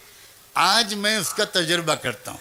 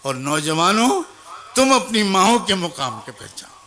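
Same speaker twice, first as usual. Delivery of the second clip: rising, louder call to the congregation; preaching exhortation; 4 dB louder than usual. Real, not acted. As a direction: urge the listeners to know their place. Preacher exhorts mothers and youth. (واعظانہ پکار، بلند ہوتی آواز میں)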